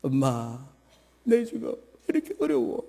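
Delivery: a married daughter begging her mother for help; voicing a troubled daughter calling home; distressed daughter. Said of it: "친정 엄마한테 전화했어".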